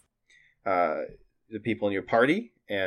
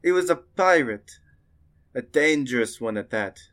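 raised, emphatic merchant voice; merchant warning; 4 semitones higher than usual. Acted. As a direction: warn of a dangerous pirate. Merchant warns about a pirate.

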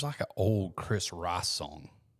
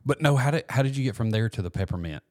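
excited; 5 semitones higher, 6 dB louder than usual. Real, not acted. Eager interruption, much higher and louder.

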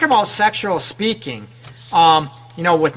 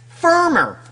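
much higher, pressed word; firm; 9 semitones higher than usual. Shown as a demonstration. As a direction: push it up firmly. Word demonstrates firm touch.

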